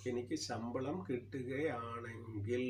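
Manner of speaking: slow, stretching the end of കിട്ടുകയാണെങ്കിൽ; drawn-out reading. Shown as a demonstration. Teacher reads out an unfinished clause, slow, കിട്ടുകയാണെങ്കിൽ stretched out. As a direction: read it slowly and leave കിട്ടുകയാണെങ്കിൽ hanging. Shows the half sentence left hanging.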